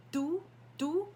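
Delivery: do, do with a light D-like dental T; tongue on the teeth for do, do; almost a D. Shown as a demonstration. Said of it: "you're almost saying a D".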